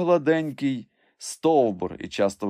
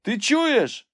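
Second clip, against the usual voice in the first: very high calling question; shout; 4 dB louder than usual. A narrator's reading. Master asks climber whether he hears, high shout. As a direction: call up to check you are heard. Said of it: "закричав Легран".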